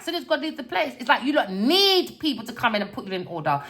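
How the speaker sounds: usual speaking voice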